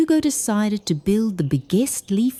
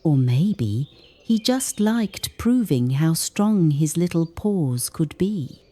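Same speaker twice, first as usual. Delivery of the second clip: ordinary delivery, nothing marked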